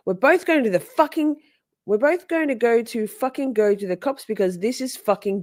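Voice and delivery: lightly hammed-up Katie; mocking Katie. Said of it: "Katie says".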